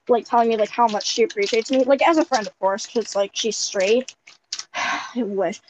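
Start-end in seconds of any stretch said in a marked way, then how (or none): none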